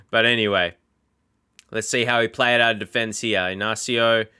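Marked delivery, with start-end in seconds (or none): none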